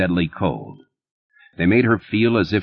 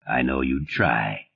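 slow, low and quiet, grave; measured, serious reply; gravely; 6 semitones lower, 5 dB quieter, slower than usual. A narrator's reading. Stark answering Liz's threat to kill him, grave and slow. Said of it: "Stark said gravely".

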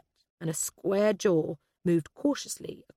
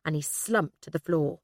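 ordinary delivery, nothing marked

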